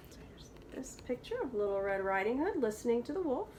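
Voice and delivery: usual speaking voice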